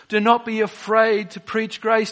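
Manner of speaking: usual speaking voice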